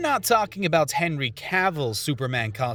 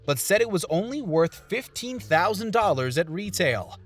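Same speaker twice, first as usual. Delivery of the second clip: ordinary talk, nothing marked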